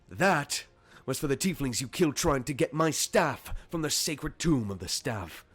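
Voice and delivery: high, loud, furious tirade; angry reproach. Acted. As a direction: hammer out the grievance after a punch. Varomir punches as he speaks.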